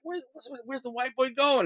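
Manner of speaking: high, soft imitation of puzzled friends; high-pitched quoted question; mocking onlookers. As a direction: lightly mimic amused buddies asking.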